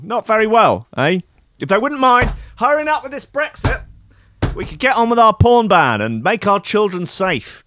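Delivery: high, loud mock-earnest jibe; sarcastic mockery. Vlogger mocking the government's porn ban, in sarcastic high voice. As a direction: sneer it like a fake cheerleader.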